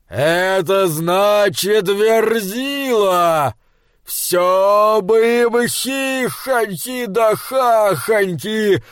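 drawn-out, grumbling giant chatter. Acted. Giant Skrymir talks at length.

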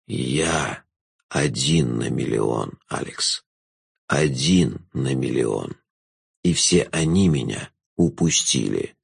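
low, slow, drawn-out jester voice; a knowing villain savouring the moment; low, slow jester passing judgement on the girl; sly jester. A narrator's reading.

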